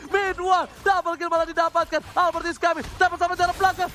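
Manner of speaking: high, loud hype calls; fighting game announcer. Real, not acted. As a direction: call the kills at full hype. Caster calls a multi-kill live.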